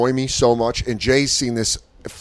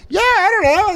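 mock shy girl; 5 dB louder than usual. Imitating shy audience girl.

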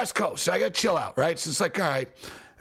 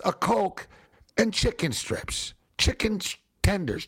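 ordinary talk, nothing marked

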